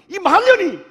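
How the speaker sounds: high, loud angry outburst; offended pastor cursing the old woman; furious insult. Retold or imitated. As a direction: spit the insult out in rage. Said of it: "막 화가 나니까".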